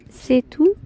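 protesting. A narrator's reading. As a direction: protest in disbelief, pitch jumping up. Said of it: "protesta Yvette". (protestation incrédule)

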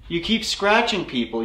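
ordinary delivery

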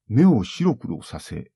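plain reading voice

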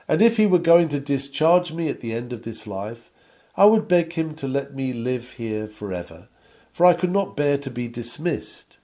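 usual speaking voice

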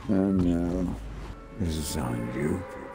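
normal talking voice

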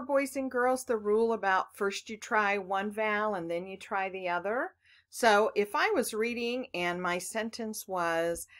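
ordinary delivery

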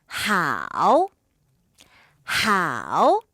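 long 하오 sinking low, then rising; third-tone demonstration. Shown as a demonstration. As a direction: dip 하오 to the bottom and bring it up slowly. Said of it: "삼성이에요". (하오를 낮게 찍고 올리는 3성으로)

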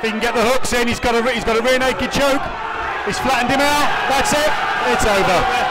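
rising, shouted call of the finish; excited commentary. Real, not acted.

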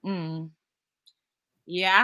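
usual speaking voice

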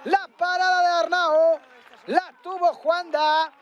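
high shouted play-by-play; excited shouting. Real, not acted. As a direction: call the chance at full voice. Dangerous attack, save.

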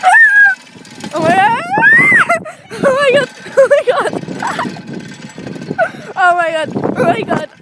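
high, loud laughing shrieks; real rush on the zip line; thrilled screams. Real, not acted.

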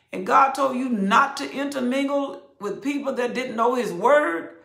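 loud, high preaching cadence; fervent preaching. Acted. Preacher to congregation, full-force preaching.

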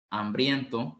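usual speaking voice

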